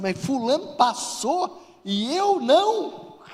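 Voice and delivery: high, loud, drawn-out mock protest; hammed-up indignant disbelief; envious outrage. Envious candidate after someone else passed, mock outraged. Acting out envy at another's success.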